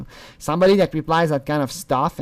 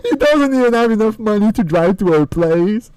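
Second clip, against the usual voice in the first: high, louder incredulous jeer; laughing mockery; 7 semitones higher, 5 dB louder than usual. Real, not acted. Mocking his lack of money to drive, high amused jeer.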